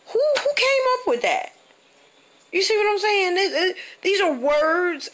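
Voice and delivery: high-pitched disbelief; can't believe anyone says this; baffled comic asking who invented yonder; incredulous. Real, not acted. Exasperated questioning.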